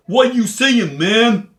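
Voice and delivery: hammed-up joking Southern drawl; Southern accent. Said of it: "joking, singing".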